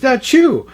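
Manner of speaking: high spoken "achoo"; storyteller's mock sneeze; acted sneeze. A narrator's reading. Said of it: "He sneezed".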